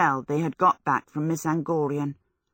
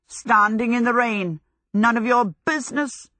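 raised, hoarse retort; 6 semitones higher, 4 dB louder than usual. A narrator's reading. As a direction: answer curtly through a sore throat. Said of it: "he said hoarsely".

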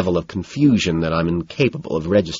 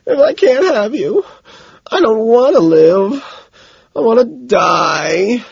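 high, slow, wailing sobs; blubbering; 5 dB louder, slower than usual. A narrator's reading. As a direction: blubber it out in loud despair. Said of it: "Then he blubbers".

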